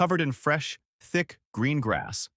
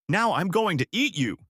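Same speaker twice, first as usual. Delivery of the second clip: higher, furious threat; angry troll; 8 semitones higher than usual. Acted. Troll leaps up to threaten the biggest goat, angry and higher. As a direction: snarl it out in anger, pitched higher. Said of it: "The troll leapt up in anger".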